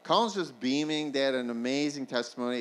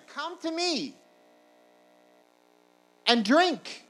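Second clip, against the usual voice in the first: high-pitched repeated call to the crowd; shouting out; 6 dB louder, slower than usual. Acted. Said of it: "he shouts out to them".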